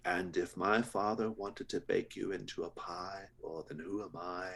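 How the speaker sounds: a dutiful son making a polite threat; sinister butcher. Acted.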